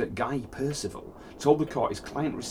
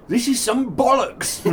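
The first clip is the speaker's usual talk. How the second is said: high, loud, drawn-out outburst; slow mock-outraged shout; 10 semitones higher, 6 dB louder than usual. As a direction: shout it as the indignant defendant, playing for laughs.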